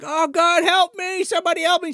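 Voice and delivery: high-pitched, hammed-up cry for help; mock desperate plea. Trapped woman begging for rescue, mock desperate plea. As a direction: plead in a put-on high victim voice.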